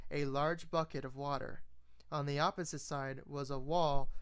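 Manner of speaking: ordinary delivery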